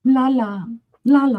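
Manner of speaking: high, airy singsong. Acted. Host acting out herself late in the show, high singsong. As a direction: lilt it high in two separate little bursts. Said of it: "I'm kinda like".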